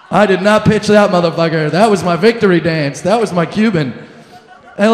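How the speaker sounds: ordinary delivery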